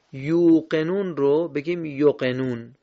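یقنون said with short u, clipped; wrong short vowel. Shown as a demonstration. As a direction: clip یقنون as a mistake. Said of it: "اشتباه خونده شده".

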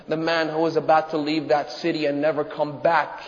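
usual speaking voice

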